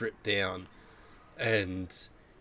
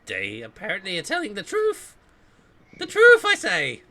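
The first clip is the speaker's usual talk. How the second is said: high, loud squeaky little pixie; tiny guard piping up, rising to a shrill cry; 10 semitones higher, 9 dB louder than usual. Acted. Tiny pixie guard declares her verdict to prisoners, high-pitched and emphatic.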